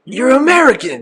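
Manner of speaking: very high mock London accent; put-on London accent. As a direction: pitch it way up as an imitated Londoner. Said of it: "You gotta hit him with the accent".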